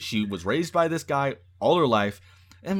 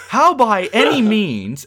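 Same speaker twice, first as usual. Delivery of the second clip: usual speaking voice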